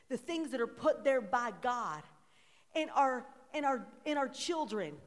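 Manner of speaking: loud, rising call-and-response sermon; full-force preaching. Acted. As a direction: preach it out, asking the crowd to answer.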